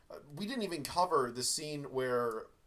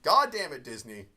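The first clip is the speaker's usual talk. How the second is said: louder mock exasperation; exasperated; 8 dB louder than usual. Real, not acted. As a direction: throw up your hands.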